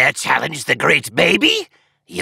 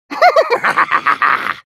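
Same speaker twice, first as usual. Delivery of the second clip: evil laugh; 2 semitones higher than usual. Acted. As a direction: laugh long and gloatingly at the foe. Villain laughs at the foe.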